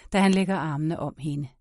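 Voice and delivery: plain reading voice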